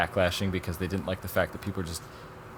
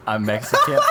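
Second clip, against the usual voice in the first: laughing through it; 8 dB louder than usual. Real, not acted. Laughs while quoting the subject.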